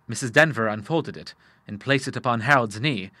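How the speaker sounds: plain reading voice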